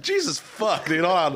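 ordinary talk

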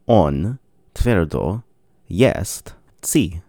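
normal talking voice

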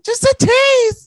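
very high, loud squeaky voice, laughing; nervous bad liar. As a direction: play a guilty liar, shrill and giggly.

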